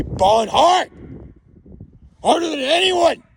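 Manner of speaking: builds from loud boast to a high shout. Real, not acted. Drunk man boasting about his future, yelling it out. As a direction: push the boast up into a yell. Drunk bragging, rising to yell.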